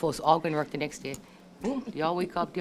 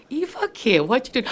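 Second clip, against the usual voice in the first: high, loud mock scolding; imitated startled challenge; catching someone out; 8 semitones higher, 6 dB louder than usual. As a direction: call out sharply to someone caught sneaking. Mom catches grandma sneaking a drink.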